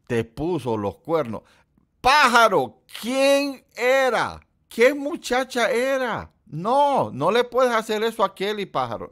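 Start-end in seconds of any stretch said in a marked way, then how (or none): 2.0-8.7 high, loud, slow teasing scold; mock outrage. Teasing Pájaro for cheating on Kelly, high loud mock outrage. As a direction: scold a friend in playful fake shock.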